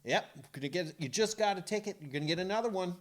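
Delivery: high-pitched fretful complaint; nagging wife. Retold or imitated. Wife warning husband about another ticket, nagging.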